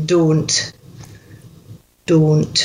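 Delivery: "Don't" with a pure long O; Scottish "Don't", modelled for a learner; Scottish O sound. Shown as a demonstration. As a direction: say "Don't" with one unglided O, each evenly. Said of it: "we need to get that O sound".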